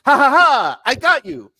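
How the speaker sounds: loud, high gloating mock laugh; Cartman. Cartman revealing his betrayal to Kyle, loud gloating imitation. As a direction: crow it like a triumphant cartoon prankster. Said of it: "And Cartman goes".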